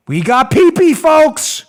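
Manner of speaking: loud mock-thrilled announcement; mock excitement. Host plays Rosenstein announcing the dossier find, mock excitement. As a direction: announce it like a big fake discovery.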